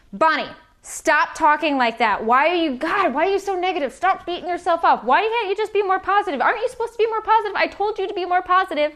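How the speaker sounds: high, fast, exasperated nagging, rising as it goes; harsh self-scolding. Shown as a demonstration. Scolding herself to show how the critic sounds, high and fast. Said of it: "it's gonna sound more like this".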